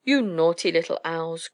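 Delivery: ordinary delivery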